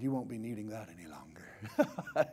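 low, soft, half-whispered line; hushed aside. Acted. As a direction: say it quietly with a private chuckle. Quiet first take, Jesus tossing coat.

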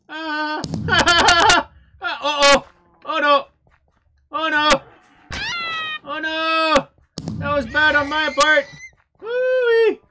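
loud panicky yells; panicked yelling. Real, not acted. Gamer chased by a hunter, yelling in panic. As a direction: yell in real alarm as he's caught.

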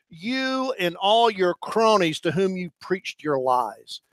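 usual speaking voice

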